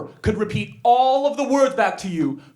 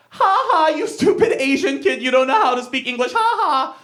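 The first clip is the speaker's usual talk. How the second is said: jeering taunt with high "ha ha"; mocking kid; 4 semitones higher than usual. Kid teases the new immigrant about English, jeering. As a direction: imitate a schoolyard bully's singsong mockery. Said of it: "an exchange looked like".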